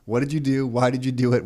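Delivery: ordinary delivery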